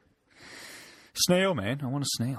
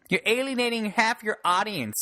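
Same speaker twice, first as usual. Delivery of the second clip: high-pitched scolding commenter; mock complaining critic; 9 semitones higher than usual. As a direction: imitate an indignant online objector in a high voice. Mimicking critic in online argument.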